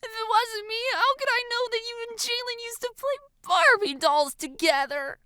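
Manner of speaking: put-on high, whiny girlish voice; desperate girl. A narrator's reading. Girl proving identity to friend, desperate. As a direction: plead your case in a high voice.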